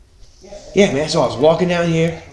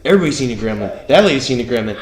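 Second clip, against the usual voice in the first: usual speaking voice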